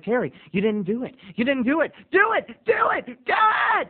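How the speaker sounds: building from muttered to high squeaky; frantic self urging. Retold or imitated. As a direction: push yourself, rising panic. Urging himself, rising panic.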